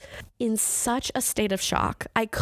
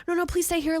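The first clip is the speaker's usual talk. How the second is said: high, pleading imitation; higher-pitched retold plea; mock begging; 9 semitones higher than usual. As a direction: imitate someone begging them to stay. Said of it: "like, begging, begging".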